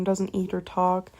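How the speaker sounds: ordinary talk, nothing marked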